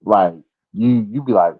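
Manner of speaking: ordinary delivery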